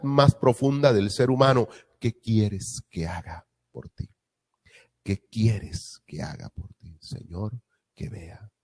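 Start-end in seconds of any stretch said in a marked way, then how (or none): 2.0-7.0 slow, soft, tender asking; gentle question. Acted. Jesus's question voiced slowly. 7.0-8.5 much softer, humble begging; hushed plea. Acted. Blind man's plea retold.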